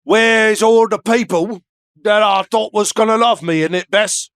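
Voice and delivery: hammed-up English accent, calling out in dismay; mock English accent. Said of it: "he has their same accent".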